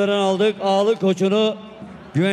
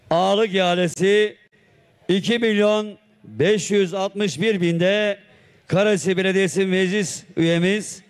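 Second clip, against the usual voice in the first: ordinary talk, nothing marked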